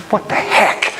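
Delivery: voiceless, strained exasperation; retold exasperated disbelief. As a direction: let it out as a frustrated gasp of words. Retold frustration as riders pass.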